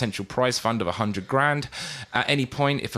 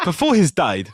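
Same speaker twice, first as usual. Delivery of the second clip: mock West African accent; 3 semitones higher than usual. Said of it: "I'm gonna do an accent".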